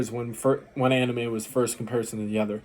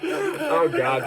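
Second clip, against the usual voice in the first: laughing; 9 semitones higher than usual. Real, not acted. Laughing at the anime scene.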